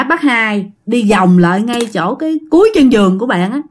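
plain reading voice

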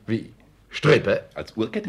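loud, astonished question; can't believe a string as a watch chain; incredulous. Acted. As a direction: burst out in disbelief.

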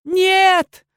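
high loud cry of refusal. Acted. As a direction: shout it as you're captured. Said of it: "воскликнула я".

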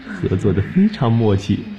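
normal talking voice

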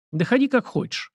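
high-pitched, offhand brush-off; dismissive. A narrator's reading. Prince letting grandson keep his long hair, offhand and dismissive. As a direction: wave him away, pitched well up. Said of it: "отмахнулся князь".

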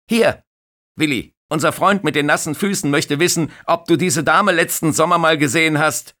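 high, loud amused colleague voice; young lifeguard teasing to his older colleague; jokey young lifeguard. Acted. (junger Bademeister, laut und belustigt)

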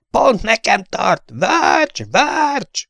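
very high, slow, panicked calling; terrified cry. A narrator's reading. Trapped gunner screaming to Verge as plane attacks, terrified. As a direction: shriek his friend's name in terror.

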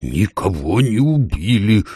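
slow, drawn-out exasperated call; exasperated owl. A narrator's reading. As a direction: lose patience, stretching every word. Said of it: "вышла из себя сова". (сова выходит из себя)

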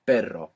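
"Perro" with a trilled R; "Perro", air flicking the tongue into a trill; rolled double R. Shown as a demonstration. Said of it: "you let that flick it".

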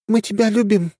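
high, frank and open; plain-spoken young heroine; young woman voice. Acted. Ariel speaks openly.

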